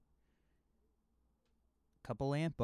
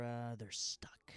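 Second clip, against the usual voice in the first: whisper; 4 dB quieter, slower than usual. Real, not acted. Retold suspense.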